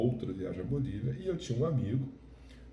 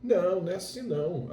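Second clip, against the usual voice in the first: friend correcting; 9 semitones higher than usual. Retold or imitated. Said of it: "meu amigo me corrigiu".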